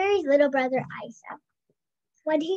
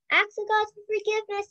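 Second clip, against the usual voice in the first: yell; 7 semitones higher, faster than usual. A narrator's reading. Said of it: "yelled Ivy".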